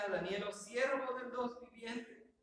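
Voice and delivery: saddened voice. Acted. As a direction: ask it grieving, drawing the words out. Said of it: "Llegó diciendo con esa voz entristecida".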